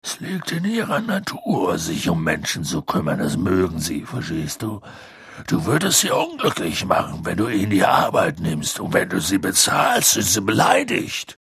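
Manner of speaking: low, patient giant's voice; a big man setting a girl straight; Hagrid explaining. A narrator's reading.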